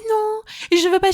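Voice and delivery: a hint of a fussy child refusing food; husband refusing a dish, mildly sulky; whiny husband. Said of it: "Il me disait".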